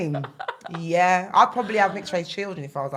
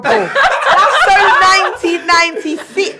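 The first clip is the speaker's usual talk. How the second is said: high, loud and slow through laughter; laughing mockery; 11 semitones higher, 10 dB louder, much slower than usual. Real, not acted. Mocking Liverpool to her friend, laughing loudly. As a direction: laugh it out as a scornful joke.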